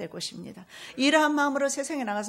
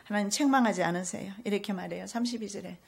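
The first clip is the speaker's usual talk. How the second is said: usual speaking voice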